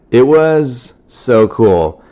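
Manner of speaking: ordinary talk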